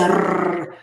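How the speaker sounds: a long trilled rrr for the double R. Shown as a demonstration. Guest explains two R's together, rrr rolled out. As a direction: roll the rrr on the tongue. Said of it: "if you have two R's together".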